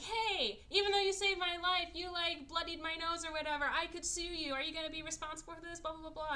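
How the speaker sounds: high, pestering mock complaint; annoying character trope. Woman badgering the man who rescued her, annoyingly high. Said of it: "that annoying character trope of".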